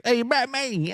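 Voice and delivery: high, swallowed consonants; mock British accent. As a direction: play a bad Brit dropping consonants. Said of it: "You didn't say any consonants".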